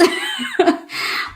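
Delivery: breathy high-pitched giggle; a quick breathless laugh; high airy giggle. Real, not acted. Laughing at own sporadic content.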